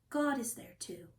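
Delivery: usual speaking voice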